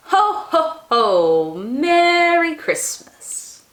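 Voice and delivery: booming slow ho ho ho, Merry Christmas drawn out; jolly Santa. Acted. Santa laughing his greeting to the household, slow jolly boom. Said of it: "shook when he laughed".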